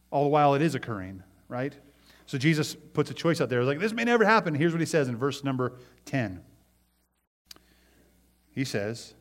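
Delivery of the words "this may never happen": indignant outcry. Retold or imitated. Pharisees' hearts refuse it.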